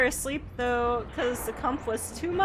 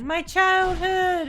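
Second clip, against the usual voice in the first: nostalgic gushing viewer; 7 semitones higher, 6 dB louder, much slower than usual. Retold or imitated. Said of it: "Everyone comes in here is like".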